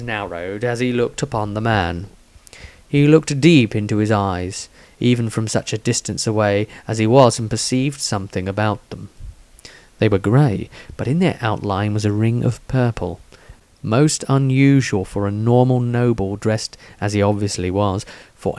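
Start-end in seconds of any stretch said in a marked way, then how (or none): none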